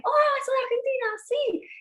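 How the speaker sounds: excited recognition. Retold or imitated. Meeting a fellow Argentine, excited high gushing. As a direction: squeal it like you've just met a fellow countrywoman.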